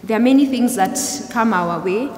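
ordinary talk

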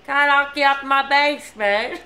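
put-on Australian accent, pushed a bit; attempted Australian accent. Trying to copy the host's trick, attempted Australian accent. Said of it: "How do you do that?".

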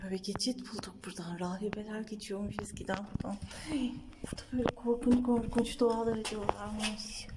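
hushed ghost-story whisper; spooky whisper. Pretend. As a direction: whisper it to give them chills. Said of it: "Korkutamadım".

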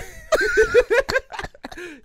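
squealing high-pitched laugh. Real, not acted. Laughing at his own Scouse attempt, a high squeal. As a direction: burst into a shrill giggle.

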